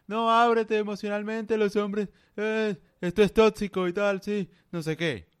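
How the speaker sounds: high put-on parody voice; mocking women. Ridiculing women who tell men to open up, high mock voice. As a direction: pitch it up and make it sound silly.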